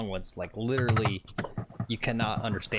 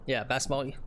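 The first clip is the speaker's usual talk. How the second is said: ordinary talk, nothing marked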